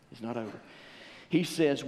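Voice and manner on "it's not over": softer, lower echo of It's not over; quiet repeat. Shown as a demonstration. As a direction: drop it to a hush.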